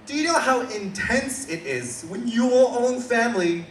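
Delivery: rising outraged punchline to the crowd; building outrage. Real, not acted. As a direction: build it up to a loud peak. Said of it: "Come on".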